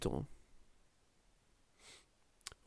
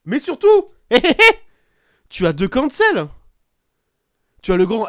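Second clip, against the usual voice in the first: laughing outburst; 9 semitones higher, 12 dB louder than usual. Real, not acted. Laughing announcement of scandal.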